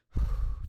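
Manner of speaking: loud voiceless sigh of disbelief; exasperated sigh. Real, not acted. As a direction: blow out a big frustrated sigh. Stuck on the bench for recess.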